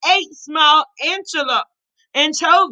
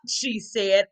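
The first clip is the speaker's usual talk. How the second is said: ordinary delivery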